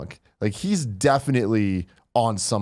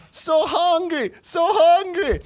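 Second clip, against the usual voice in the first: high, drawn-out goofy voice; wobbly high stoner whine; stoned guy's line; slower than usual. Retold or imitated. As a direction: stretch it out like a giggly stoned kid. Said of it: "He comes back again".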